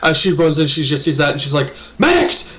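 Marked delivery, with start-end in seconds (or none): 2.0-2.5 urgent call. Acted. Bear lady calling for medics.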